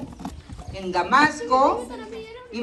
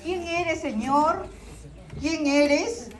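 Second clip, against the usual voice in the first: startled question; 5 semitones higher, much slower than usual. Acted. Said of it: "estaba sorprendido, dice".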